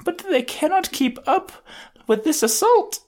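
put-on very high timid anime girl voice; shy anime girl voice. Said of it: "shy girls do in anime".

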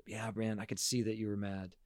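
soft, quiet validating voice; gentle empathic reply. Shown as a demonstration. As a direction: answer the child gently and quietly. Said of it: "but rather respond".

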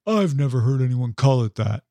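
lightly acted shrug-off; mock dismissal. Imagining people who left it at that, mildly dismissive. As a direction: imitate someone leaving it at that. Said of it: "and left it at that".

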